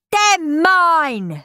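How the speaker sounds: loud, high possessive yell; screaming. Acted. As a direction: clutch the loot and yell. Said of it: "screamed Henry".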